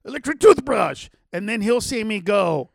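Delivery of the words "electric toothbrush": imitating a player calling out guesses; raised voice, blurting each guess; mock game-night guessing, louder; called-out guesses. Acting Bert's Pictionary guesses.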